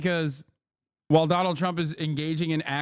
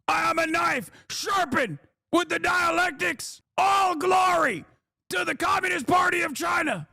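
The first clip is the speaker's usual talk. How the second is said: much higher, shouted pledge to the chairman; fanatic loyalty shout; 11 semitones higher than usual. Pretend. A fanatic pledging himself to the chairman, high shouting. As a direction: bellow your devotion like a zealot soldier.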